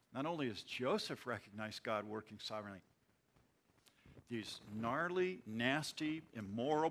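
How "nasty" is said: usual speaking voice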